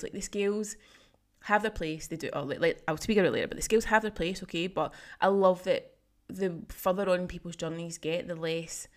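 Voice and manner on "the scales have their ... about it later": ordinary delivery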